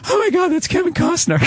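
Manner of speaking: put-on high-pitched starstruck little girl; mock girlish gushing, way up high. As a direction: squeal it like a girl fan. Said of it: "went like a little girl".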